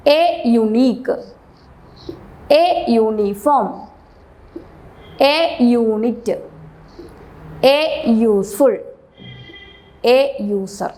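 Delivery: എ stressed and slowed before each word, with pauses between; slow drill. Shown as a demonstration. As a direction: read every "എ ..." slowly, leaning on എ. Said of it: "article എ ആയിരിക്കും വരുന്നത്". (എ എന്നതിൽ ഊന്നി സാവധാനം ഓരോന്നും വായിക്കുക)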